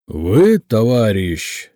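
loud, drawn-out angry address; booming official rebuke; deafening angry boss. A narrator's reading. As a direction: thunder it slowly at a subordinate. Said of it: "оглушая Короткова кастрюльными звуками".

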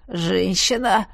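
slow hoarse call; hoarse prisoner. Acted. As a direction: rasp it out like a worn prisoner. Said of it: "Хриплый голос".

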